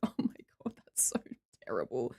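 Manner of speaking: a mortified whisper; embarrassed whisper. Real, not acted. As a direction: hush it out, mortified. Missed super chat reply, embarrassed.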